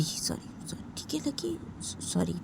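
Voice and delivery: soft, whispered sorry; whispered apology. Acted. Boyfriend apologizing to crying girlfriend, soft whisper. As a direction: murmur the apology gently.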